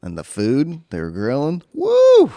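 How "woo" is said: excited whoop. Real, not acted. Excited about the grilled food.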